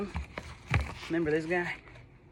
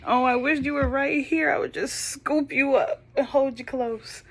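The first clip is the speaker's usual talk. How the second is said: tender longing; 8 semitones higher, 5 dB louder than usual. Real, not acted. Longing to hug distant child.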